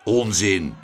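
low dismissive grumble; grumbling. A narrator's reading. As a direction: brush it off in a low voice. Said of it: "bromde meneer Tump".

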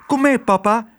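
put-on high, insistent child voice; urgent girl's voice. Girl pulling her father out of the building, high urgent voice. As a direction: urge him along in a high voice.

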